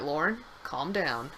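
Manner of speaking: soft, low, soothing; calming teacher. Retold or imitated. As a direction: hush her like a calm teacher. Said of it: "she was like".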